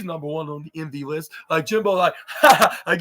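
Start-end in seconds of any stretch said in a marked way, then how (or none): 2.3-2.8 higher, louder put-on ha-ha; mock laugh. Jimbo reacting to the joke, mock laugh. Acted reply to being slapped.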